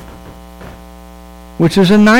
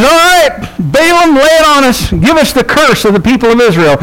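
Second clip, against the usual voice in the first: higher, eager demanding king; eager king Balak; 6 semitones higher than usual. Retold or imitated. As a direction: rub your hands and press him for the curse.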